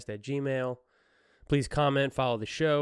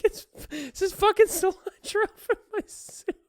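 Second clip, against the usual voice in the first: high, cracking up through the protest; high mock-indignant wail breaking into laughter; laughing mock outrage; 3 dB louder than usual. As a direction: squeal it while laughing at her own impression. Retelling woman's outraged protest.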